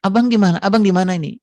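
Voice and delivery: high, loud, gushing wife answering the phone; wife overjoyed her husband is calling; delighted wife. Retold or imitated. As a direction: squeal with joy at hearing her husband. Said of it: "dia jawab".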